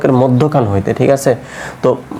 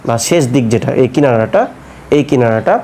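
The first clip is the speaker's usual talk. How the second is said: ordinary talk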